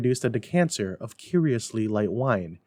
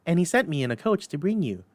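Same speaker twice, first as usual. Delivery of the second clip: put-on little girl voice; 7 semitones higher, faster than usual. A narrator's reading. Child continues.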